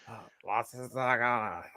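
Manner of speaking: a halting attempt at a German accent. Host trying a German accent on request, tentative German accent. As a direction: fumble into a weak German accent, softly. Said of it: "Now try and do that with a German accent".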